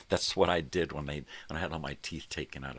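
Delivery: ordinary delivery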